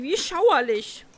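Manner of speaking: slow, high, loud storybook horror; horrified shudder. A narrator's reading. Princess thinking of the frog, horrified shudder. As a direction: draw out her revulsion, pitched high.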